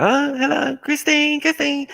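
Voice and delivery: very high sing-song greeting; visitor greeting mum. Retold or imitated. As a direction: call Christine in a high mock voice.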